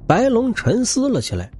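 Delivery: ordinary delivery, nothing marked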